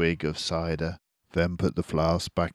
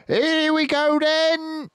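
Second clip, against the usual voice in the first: much higher, louder, slow yell; shouting Bean; 7 dB louder, slower than usual. A narrator's reading. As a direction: roar over the engines. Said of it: "shouted Bean".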